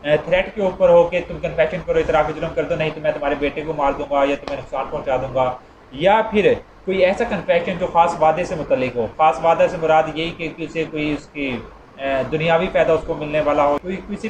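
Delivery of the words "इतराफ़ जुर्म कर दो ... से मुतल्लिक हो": ordinary talk, nothing marked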